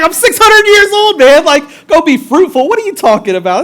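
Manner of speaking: high, loud outburst of disbelief; incredulous Noah. Acted. Preacher as old Noah answering God's command, incredulous protest. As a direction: protest loudly, pitch shooting up, hammed-up.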